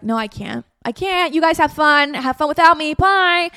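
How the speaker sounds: high, loud rushed cancelling; retold frantic bailing. Cancelling on her friends hours before, high and loud. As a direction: back out fast and shut the door.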